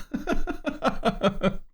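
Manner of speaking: high, soft giggle. Real, not acted. Laughing after agreeing with chat.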